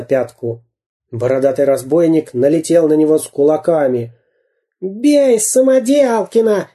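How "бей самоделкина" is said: high, drawn-out yell; wailing urging cry. A narrator's reading. Дырка yells from the pavement, wailing to attack. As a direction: wail out the order, pitch raised. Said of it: "голосил Дырка".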